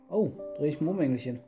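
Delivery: normal talking voice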